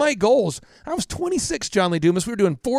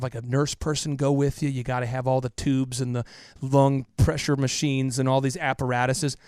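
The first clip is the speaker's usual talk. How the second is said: ordinary talk, nothing marked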